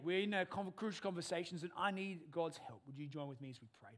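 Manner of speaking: ordinary delivery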